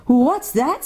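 high-pitched startled question; frightened child's question; alarmed girl. A narrator's reading. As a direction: ask it high, in sudden alarm. Frightened Lucy clutches Susan's arm.

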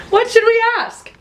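high, loud excited question; excited squeal. Real, not acted.

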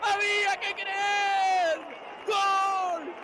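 high shouted goal cries; goal celebration. Real, not acted. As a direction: scream the winner out.

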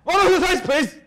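very loud, high German-style shout; mean mock German. Host parroting the guest, shouted mean mock German. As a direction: yell a polite order like an angry German caricature. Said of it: "anything they say just sounds very mean".

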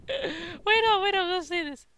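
very high voice after laughing; helpless squeaky talk. Real, not acted. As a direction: squeak it out, barely recovered. Talking through laughter.

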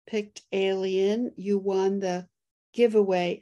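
ordinary delivery

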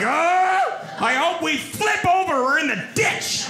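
very high, hammed-up angry yell; mock furious rant. Passenger fuming at one-armed driver, high mock-furious rant. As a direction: erupt in comic rage, pitch way up. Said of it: "I'm getting angry".

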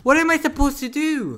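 high, loud complaint; exasperated cry. Real, not acted. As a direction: wail it in helpless frustration. Stuck and in trouble.

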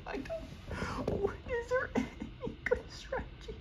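tiny high-pitched squeaky whine; despairing whine. Real, not acted. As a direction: whine it very high and soft, near tears. Despairing after failing in game.

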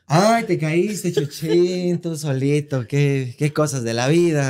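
pretend-sad cooing over the fall; hammed-up innocent mom; mock fake pity. Mother feigning pity.

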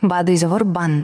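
"বান" sounded with n at the end; final n sound. Shown as a demonstration. Teacher shows learners the reading, "বান" ending in n. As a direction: close "বান" on a clear dental n. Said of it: "এভাবে".

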